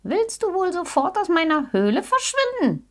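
high-pitched, cross wildcat voice; bitterly angry growl. A narrator's reading. Wildcat driving the bear out of her cave, angry growl. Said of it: "knurrte ihn die Wildkatze bitterböse an".

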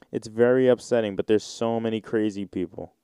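ordinary talk

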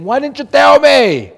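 high, loud accusing cry; desperate scream. Retold or imitated. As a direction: yell it at the one who never warned you. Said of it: "somebody screaming at you saying".

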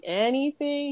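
high, lifted "Anything?" as a repeated query; imitated host querying "Anything?"; questioning host. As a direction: raise "Anything?" into a doubting question. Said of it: "And then he's like".

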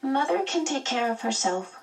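put-on high child's voice; lightly voiced young son; small boy. A narrator's reading. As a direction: state it in a little boy's high pitch.

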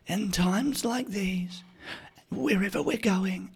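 high, soft, slow mummy voice; soothing ad voiceover. Retold or imitated. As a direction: murmur it like a comforting commercial. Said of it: "all the ads of everything was like".

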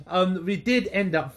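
high burst of laughter into words; laugh, then talk through it. Real, not acted. Laughs at partner's joke.

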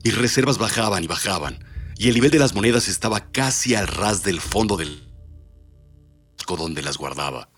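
dark, dramatic storyteller voice; horror story narration. Acted. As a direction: read it like a grim ghost tale. Reads horror story aloud.

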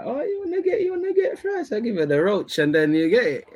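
mock girlfriend voice way up high; high girly voice. Mocking the viewer's girlfriend in a high voice. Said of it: "I know your gal is one of them, like".

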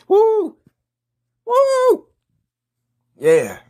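high falsetto woo, shouted out; whooping cheer. Acted. Pumped fan hyping the Bears in the parking lot, whooping. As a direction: whoop like a crazed tailgater. Tailgate hype before the Bears game.

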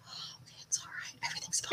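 hushed, calming whisper; soothing whisper. Retold or imitated. Parent imagining the accent calming them, soft soothing whisper. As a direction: whisper as if settling a child down.

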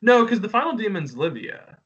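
ordinary talk

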